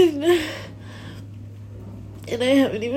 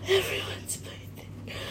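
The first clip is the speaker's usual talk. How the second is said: faint, breath-only murmur; hushed; 2 semitones higher, 12 dB quieter than usual. Real, not acted. Speaker submits under their breath, hushed. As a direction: barely voice it, almost a whisper. Repeats it louder right after.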